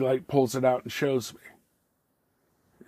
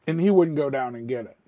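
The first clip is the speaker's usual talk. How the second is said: ordinary delivery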